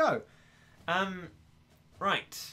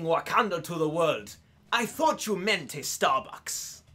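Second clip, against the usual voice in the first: African accent. Shown as a demonstration. Streamer quotes battle cries and Wakanda line, African accent. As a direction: proclaim it with a put-on African accent. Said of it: "Nigerian or African?".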